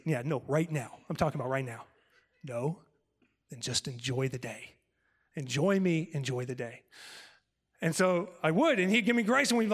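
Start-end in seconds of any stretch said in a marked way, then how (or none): 0.0-6.8 the Lord's voice. Retold or imitated. The Lord answering in his heart.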